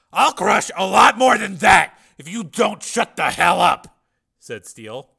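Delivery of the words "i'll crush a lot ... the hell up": slow, loud, raised menace; angry threat. Acted. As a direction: threaten him hard into silence. Threatening the captive into silence.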